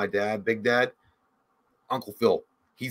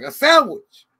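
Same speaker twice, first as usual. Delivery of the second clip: mock grandfather; 12 semitones higher, 9 dB louder, slower than usual. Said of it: "He's like".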